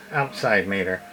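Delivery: ordinary talk, nothing marked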